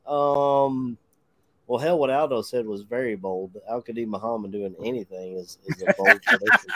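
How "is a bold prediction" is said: laughing. Real, not acted. Laughs at Aldo's bold prediction.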